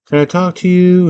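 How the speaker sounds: high-pitched, coaxing dad impression. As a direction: imitate a dad calling sweetly through the door, high. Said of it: "her dad's like".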